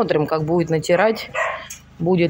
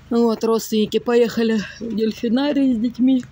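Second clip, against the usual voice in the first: ordinary delivery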